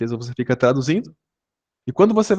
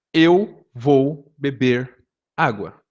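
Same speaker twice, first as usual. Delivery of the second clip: slow example phrase; much slower than usual. Shown as a demonstration. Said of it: "Vou dar um exemplo. Português".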